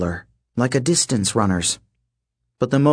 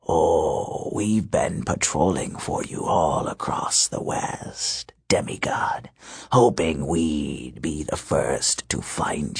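low menacing wolf king; 6 semitones lower, 4 dB quieter, slower than usual. Acted. Wolf king threatens the demigods.

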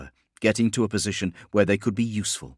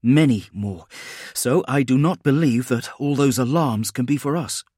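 higher, louder tense warning; urgent commander; 5 semitones higher, 5 dB louder than usual. Acted. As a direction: think aloud under pressure. Spinoza reasons while running.

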